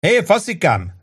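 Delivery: high call to the far end of the bar; hollering for the bartender; shout. A narrator's reading. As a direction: yell down the counter. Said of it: "kiáltotta pult másik vége felé".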